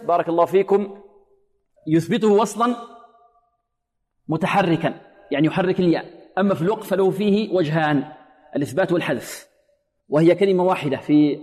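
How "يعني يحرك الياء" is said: ordinary talk, nothing marked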